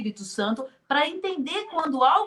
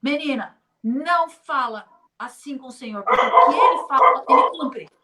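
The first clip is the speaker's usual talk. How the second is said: imitating an irritated scolding that gets much louder; retold rebuke, building to a forceful finish; angry scolding; 10 dB louder than usual. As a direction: scold her like an offended church sister. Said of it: "Ficou com raiva e falou assim".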